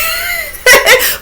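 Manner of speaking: high squealing laugh; shrieking laugh. Real, not acted. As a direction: let out a squealing cackle.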